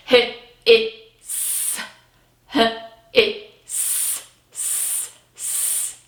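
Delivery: S as a soft unvoiced hiss. Shown as a demonstration. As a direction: stretch out the s sound. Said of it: "that last sound".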